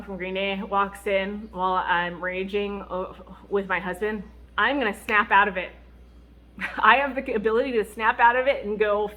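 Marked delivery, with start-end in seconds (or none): none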